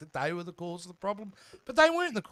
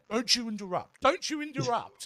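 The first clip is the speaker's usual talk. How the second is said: retold scolding; 6 semitones higher than usual. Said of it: "because he keeps saying".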